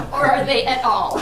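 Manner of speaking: laughing. Real, not acted. Joking about bigger names.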